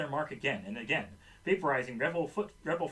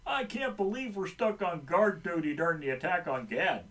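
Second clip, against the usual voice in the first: gruff extremist guard grumbling; 3 dB louder than usual. A narrator's reading. Extremist grumbling on guard duty, gruff voice. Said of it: "says in a gruff voice".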